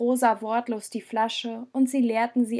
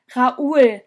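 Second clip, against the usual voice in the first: loud desperate plea of his name; pleading call; 8 dB louder than usual. Acted. Jonas begging Raoul to stay, loud plea. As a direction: call him back, begging. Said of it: "flehte Jonas ihn an".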